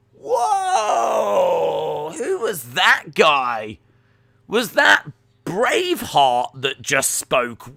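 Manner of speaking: loud, hammed-up awe; high, stretched shout of disbelief; mock astonishment. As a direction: play a blown-away listener. Acting amazed reaction to his accent.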